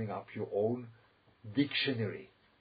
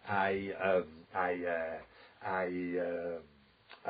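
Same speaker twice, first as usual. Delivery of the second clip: hesitant self-talk; 4 semitones lower, much slower than usual. Retold or imitated. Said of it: "they begin to speak and they say".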